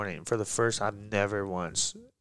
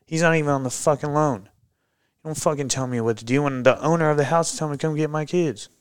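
raised, higher, pushing every line hard; retold heated arguing; 5 semitones higher, 3 dB louder than usual. Arguing with the police supervisor on Thanksgiving, heated raised voice. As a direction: plead your case hot and fast.

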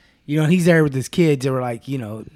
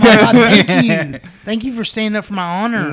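loud, raised mock gushing; gushing gratitude; 5 semitones higher, 7 dB louder than usual. Wife thanking husband for standing up for her, gushing. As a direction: burst out with hammed-up thanks, imitating a wife.

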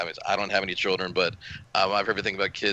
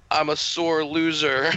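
put-on slow, higher voice of a tearful kid; crying kid; 7 semitones higher, 3 dB louder, slower than usual. Retelling what Mikey said after crying, slow higher kid voice. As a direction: play an eight-year-old sniffling out a confession slowly. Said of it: "He's like".

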